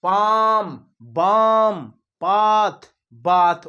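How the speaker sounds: teacher calling out sample words; example words called out. Shown as a demonstration. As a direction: call out each example word clearly. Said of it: "जैसे कि".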